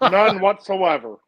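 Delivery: talking through a mouthful of food; mouth full. Real, not acted. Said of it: "Well, your mouth is full".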